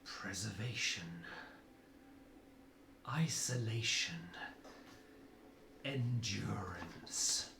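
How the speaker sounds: low whispered icy chant; muttered villain incantation. Acted. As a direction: hiss it out like a cold sorceress. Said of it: "and mutters".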